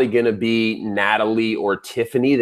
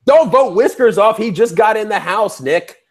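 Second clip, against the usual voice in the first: higher, playfully indignant protest; mock outrage; 6 semitones higher than usual. Co-host pleading with host to spare Whiskers, mock outrage.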